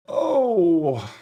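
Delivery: loud, high, falling wail; anguished groan. Real, not acted. Bettor torn over a tempting pick, anguished groan.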